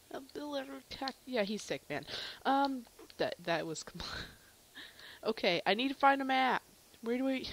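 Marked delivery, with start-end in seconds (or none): none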